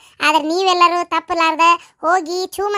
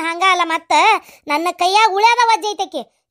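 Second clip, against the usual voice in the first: ordinary talk, nothing marked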